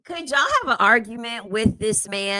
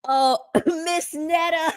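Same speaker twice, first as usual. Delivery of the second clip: laughing; 7 semitones higher, slower than usual. Real, not acted. Laughing, playful put-on voice.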